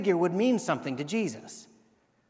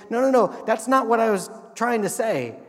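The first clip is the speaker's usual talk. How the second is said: higher and louder no no no; urgent loud correction; 5 semitones higher, 8 dB louder than usual. Retold or imitated. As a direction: stop the misunderstanding quickly. Centurion correcting through friends.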